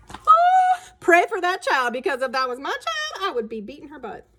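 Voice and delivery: high, loud, outraged exclamation; exasperated aside. Real, not acted. Narrator reacts to the bratty child, high and exasperated. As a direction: throw up your hands at the brat.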